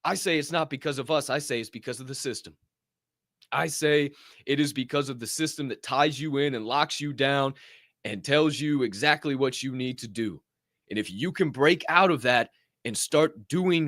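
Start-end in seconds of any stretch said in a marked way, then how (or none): none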